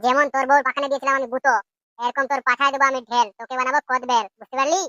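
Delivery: mocking imitation of Futo. MLA mocks captive Futo by parroting his squeaky voice. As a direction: throw his squeaky threats back at him. Mimics Futo's taunt back.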